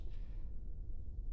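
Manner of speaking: long, tired breath out through the mouth; weary sigh. Acted. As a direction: sigh like you can't shake the worry. Alone, anxious after oversleeping.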